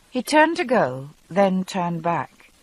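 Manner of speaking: ordinary talk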